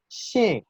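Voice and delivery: "Xin" starting high and falling sharply; fourth-tone demonstration. Shown as a demonstration. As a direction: drop "Xin" firmly from the top. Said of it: "cuarto tono". ("Xin" en cuarto tono, descendente)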